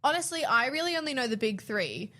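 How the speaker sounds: normal talking voice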